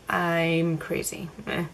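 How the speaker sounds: usual speaking voice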